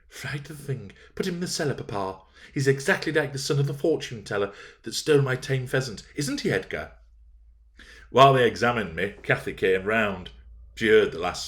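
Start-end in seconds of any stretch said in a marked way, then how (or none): 0.1-6.9 put-on higher, lisping spoilt child; a small girl lisping her complaint; lisping little girl. A narrator's reading. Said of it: "Isabella lisping".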